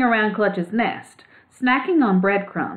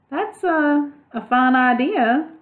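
timidly; 7 semitones higher, slower than usual. A narrator's reading. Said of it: "he said timidly".

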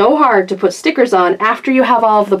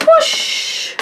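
high, airy whoosh sound effect; imitated whoosh. Toy reviewer sliding pets down a zip line, imitated whoosh. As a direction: whoosh like something zipping down a line.

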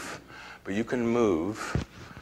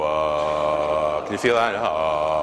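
low chest note; 5 semitones lower, 4 dB louder, much slower than usual. Shown as a demonstration. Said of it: "do it low".

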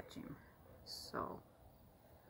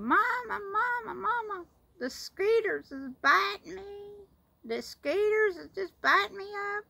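put-on high, loud little-girl whine in a Florida cracker accent, slowing on the skeeters; 11 dB louder than usual. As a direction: call for Mama as a small Southern child in Florida cracker twang. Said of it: "had such a funny accent".

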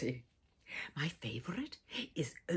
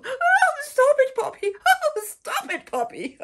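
squealing high yell, much louder; 12 dB louder than usual. Acted. Child squealing at Poppy chasing with the hose, high and loud. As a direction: shriek playfully at the hose. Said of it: "and I yell".

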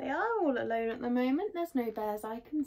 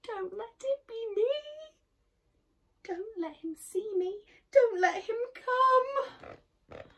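whimpered; 11 semitones higher than usual. A narrator's reading. Hidden boy begs not to be found, whimpering. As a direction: beg in mounting fear. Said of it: "whimpered Eddie".

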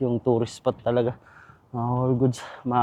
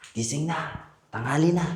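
calling out; 8 semitones higher than usual. Real, not acted. Waking sleeping partner up.

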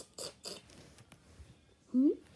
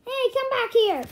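angry call; 6 semitones higher, 4 dB louder than usual. Acted. Villain calls after one escaping.

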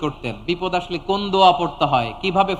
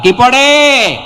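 loud, high call to the crowd; shouted question; 5 semitones higher, 10 dB louder, much slower than usual. Real, not acted. Preacher quizzing the congregation mid-nasheed, shouted question. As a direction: fling the question out loudly. Breaks off song to quiz crowd.

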